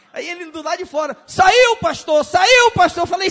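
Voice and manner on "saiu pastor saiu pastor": very high, loud, excited cheering; jubilant shout. Retold or imitated. Neighbor outside cheers to the pastor that the demon left, very high and loud. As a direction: cheer it out in relief.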